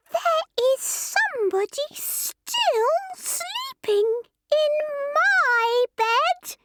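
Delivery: very high, slow squeaky cry; high little bear voice, drawn out; squeaky Baby Bear. A narrator's reading. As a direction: squeal it like an astonished tiny cub. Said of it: "he cried in his squeaky voice".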